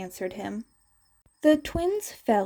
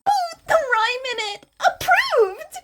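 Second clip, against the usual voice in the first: much higher, squealing with delight; gushing teacher; 5 dB louder than usual. Acted. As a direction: gush like a thrilled teacher. Midnight gushing over hero names.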